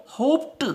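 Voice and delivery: होप्ड ends on a t sound, not d; hoped with t ending. Shown as a demonstration. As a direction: say होप्ड as hopt. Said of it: "ट बोलेंगे, न कि ड".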